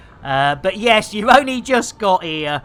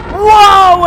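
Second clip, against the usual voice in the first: surprised; 12 semitones higher, 12 dB louder than usual. Real, not acted. Sudden loud exclamation.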